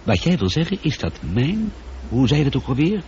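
ordinary delivery

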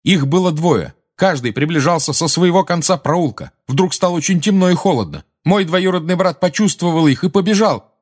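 high, urgent, loud testimony; loud over murmurs. A narrator's reading. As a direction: push on over the muttering crowd. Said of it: "перекрыв очередную волну шёпота на скамьях".

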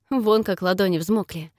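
usual speaking voice

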